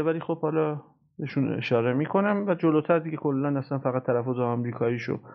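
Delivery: ordinary talk, nothing marked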